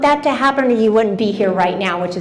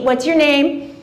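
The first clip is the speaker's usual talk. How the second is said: usual speaking voice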